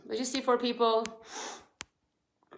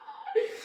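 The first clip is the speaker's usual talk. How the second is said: tearful laugh; 11 semitones higher, 6 dB quieter than usual. Real, not acted. Emotional laugh on live video.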